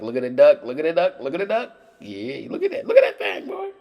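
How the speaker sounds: climbing from loud and high to a squeal; mounting giddy excitement. Real, not acted. Cook gloating over his duck, excitement building to squeals.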